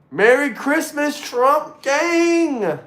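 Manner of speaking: high, slow holiday shout; festive cheer. Real, not acted. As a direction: call it out to the crowd.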